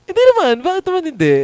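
high, loud mock-indignant protest. Real, not acted. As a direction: push back, high and incredulous. Friend protests refusing bawdy topic.

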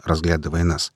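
ordinary delivery, nothing marked

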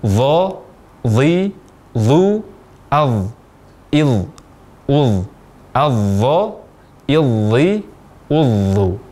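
"adh, idh, udh" as separate syllables with emphatic dh; thick tafkhim dha. Shown as a demonstration. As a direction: repeat drill, "Dha, dhi, dhu" with heavy voiced th. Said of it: "Saya ulang kembali".

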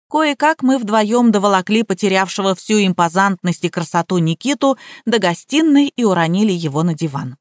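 ordinary delivery, nothing marked

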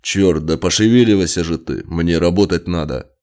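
loud, impatient order; irritated. A narrator's reading. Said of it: "раздражённо поджал губы".

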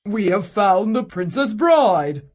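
low, loud, slow joyful call; happy cry. A narrator's reading. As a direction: announce it with joy. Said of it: "cried the king's men happily".

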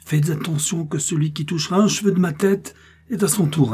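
high-pitched, indignant warning; threatening warning. A narrator's reading. A captive woman threatening whoever touches her, threatening warning.

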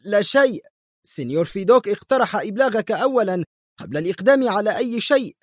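confused, defensive voice; flustered. A narrator's reading. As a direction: answer hurriedly, caught off guard. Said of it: "أجابه مرتبكا".

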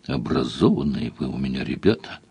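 low, soft, musing tone; as if thinking aloud, softly; thoughtful. A narrator's reading. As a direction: say it pensively, dropped low and quiet. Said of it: "сказал Сварог задумчиво".

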